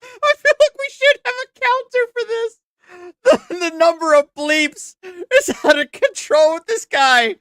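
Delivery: high, squeaky voice through laughter; laughing. Real, not acted. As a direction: struggle to talk while cracking up. Cracking up at the bleeped rant.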